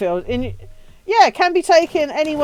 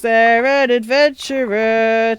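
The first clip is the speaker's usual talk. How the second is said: slow drawn out; much slower than usual. Pretend. Slowed repeat of question.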